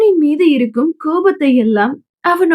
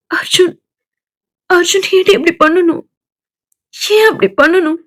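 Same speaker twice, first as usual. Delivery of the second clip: crying question; 4 semitones higher than usual. Acted. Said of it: "அழுது கொண்டே மாலதியிடம் கேட்க".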